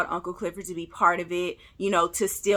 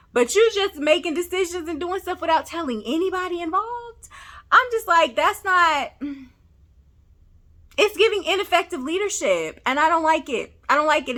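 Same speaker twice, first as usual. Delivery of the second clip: raised, higher pitched exasperation; exasperated rant; 7 semitones higher than usual. Real, not acted. Vlogger ranting at Haley's leadership, raised exasperated voice.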